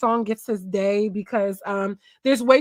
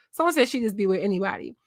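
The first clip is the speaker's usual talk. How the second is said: ordinary talk, nothing marked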